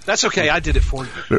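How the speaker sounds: normal talking voice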